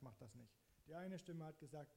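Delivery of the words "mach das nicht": inner voice holding back, lower and softer; the counter-voice, Mach das nicht, dropped low and quiet; other inner voice refusing. Retold or imitated. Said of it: "die andere Stimme hat gesagt".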